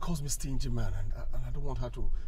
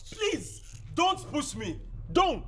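much higher, strained warning; slower than usual. Acted. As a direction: warn her, voice straining high. Husband warning wife during fight.

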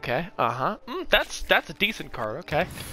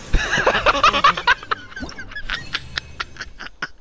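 high wild laugh. Real, not acted. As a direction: cackle with delight at the win. Laughing at winning.

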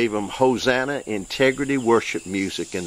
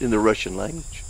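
usual speaking voice

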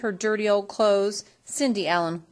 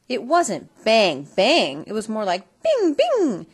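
gunshot sounds; 2 semitones higher, 7 dB louder than usual. A narrator's reading. Narrator mimicking the fairy pistol, loud and ringing. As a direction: ring out the magic shots.

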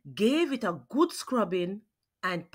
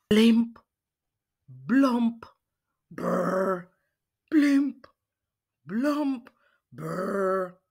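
blimp blump bubbling sounds; bubbling noises; much slower than usual. Acted. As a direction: gurgle the pot noises. Octopus noises in kitchen.

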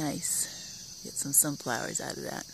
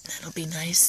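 whisper. Real, not acted. Gardener looking at delicata squash, whispering. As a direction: hush it fondly.

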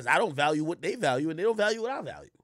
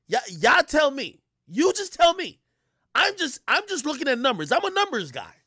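high, loud and pushing hard; hyped challenge; 10 semitones higher, 8 dB louder than usual. Real, not acted. To his audience, hyped and daring about view numbers. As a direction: dare the audience to argue with the numbers.

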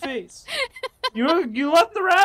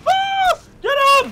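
very high, loud panicked yell; cover me shout; 10 dB louder than usual. Real, not acted. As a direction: scream for help in the fight. Fight panic.

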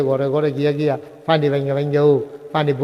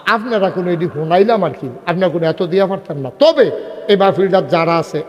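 normal talking voice

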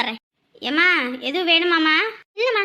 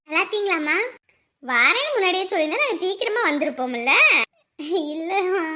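normal talking voice

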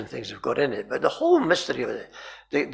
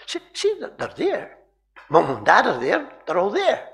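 higher, insistent pointing-out; coaxing a child; 6 semitones higher, 3 dB louder than usual. Retold or imitated. Bystander points crying girl to the figures, coaxing. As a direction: plead with a child to see.

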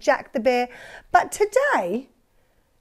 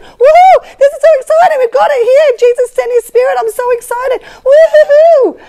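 much higher, louder joyful whoops; excited cheering; 11 semitones higher, 12 dB louder than usual. Pretend. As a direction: cheer wildly with joy.

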